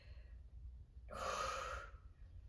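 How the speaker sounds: slow, soft sigh out; long breathy exhale into the stretch. Real, not acted. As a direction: let the breath go in a long hush.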